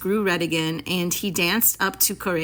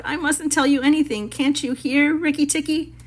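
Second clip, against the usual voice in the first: high tearful whimper; frightened, near-tears pleading; crying; 7 semitones higher than usual. Acted. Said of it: "Chuchundra sat down and cried".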